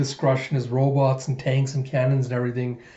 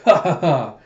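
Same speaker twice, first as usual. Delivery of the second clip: loud, acted "ha ha ha"; villain laugh; 3 semitones higher, 6 dB louder than usual. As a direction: laugh boldly as the warlord. Den laughs at Koyomi.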